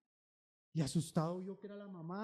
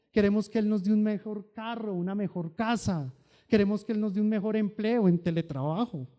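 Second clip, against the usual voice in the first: normal talking voice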